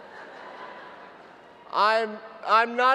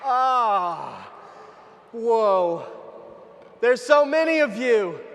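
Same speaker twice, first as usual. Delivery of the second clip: ordinary talk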